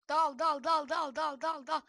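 loud, high rapid chant of dal; urgent urging. Real, not acted. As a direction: push the friend to attack. Saldırı için tekrar tekrar bağırma. (heyecanla dal diye bağırma)

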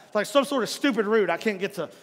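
ordinary talk, nothing marked

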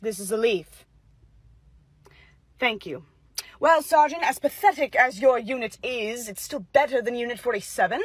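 low and unhurried authority; stern colonel. Acted. Colonel inspecting troops.

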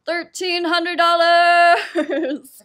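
slow, loud, high game show announcer. Retold or imitated. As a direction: stretch it out like a TV host. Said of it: "In his best announcer voice".

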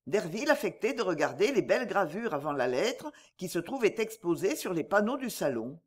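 plain reading voice

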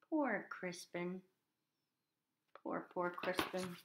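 soft, slow sympathy; pitying. A narrator's reading. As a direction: murmur it with pity for the little dragon. Pitying the runaway dragon.

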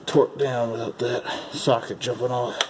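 ordinary talk, nothing marked

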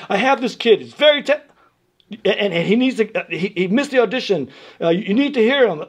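insistent teacher. Retold or imitated. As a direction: press the school to take the kid, stumbling over words. Retold urgent phone call to school.

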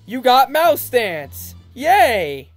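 slow, loud reward call; excited announcement. Acted. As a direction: announce the prize grandly. Reading item reward.

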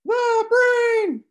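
squeaky, very high and loud falsetto; loud chipmunk-style falsetto squeal. Acted. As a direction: shriek it like a cartoon chipmunk. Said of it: "Alfred and the Chipmunks falsetto".

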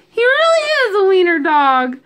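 high, loud teasing; mocking jeer. A narrator's reading. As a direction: poke fun at him in a loud high voice. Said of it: "they howled with laughter".

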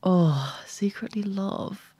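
soft, whispery musing to yourself; hushed musing. Real, not acted. Mulling over the friend's fashion question, hushed musing. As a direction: murmur it under your breath, slowly.

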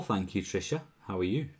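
normal talking voice

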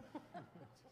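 soft helpless laughter; giggling at a dark joke; real laugh. Real, not acted. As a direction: laugh quietly at the memory. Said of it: "Sorry, I thought that was really funny".

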